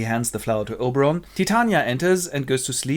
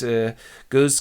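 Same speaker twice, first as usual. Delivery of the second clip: ordinary delivery, nothing marked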